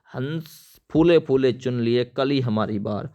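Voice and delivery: ordinary delivery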